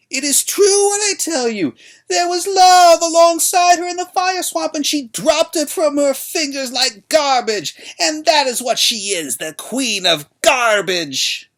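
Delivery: high, loud, slow hag's voice; old crone haranguing. A narrator's reading. Old woman shouting to the crowd, high and loud. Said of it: "She turned to the crowd".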